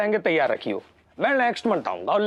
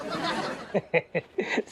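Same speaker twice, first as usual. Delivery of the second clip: soft, low staccato chuckle; 5 semitones lower, 6 dB quieter than usual. Acted. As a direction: chuckle to yourself before answering. Odd customer chuckles before answering.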